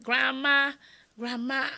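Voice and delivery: loud, high call across the house. Retold or imitated. Children calling for grandma.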